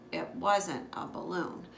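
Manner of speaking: ordinary delivery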